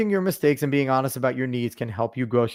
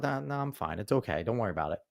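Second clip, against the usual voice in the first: lower, much softer sulky I'm fine; mock sulky fine; 5 semitones lower, 11 dB quieter, faster than usual. Partner hiding upset, mocked as a low, soft sulk. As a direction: mutter the fake fine like she's hiding upset. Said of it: "you'll get that side of".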